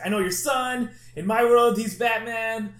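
high, loud, urgent insisting; urgent pleading. Retold or imitated. Barry insisting to Thomas Wayne about his son, urgent high plea. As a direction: convince him fast, urgently. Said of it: "Barry's like".

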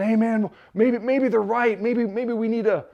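high-pitched mock panic; panicking. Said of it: "all these other guys are panicking".

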